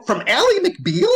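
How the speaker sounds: incredulous, high, loud and drawn out; retold disbelief. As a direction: act out baffled disbelief, voice leaping up. Teller reacts to the casting.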